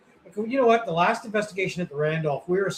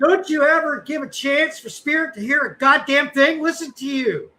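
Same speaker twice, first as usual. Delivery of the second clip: high, louder mock scolding; retold scolding; 8 semitones higher, 4 dB louder than usual. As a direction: nag him for talking too much. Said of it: "She's like".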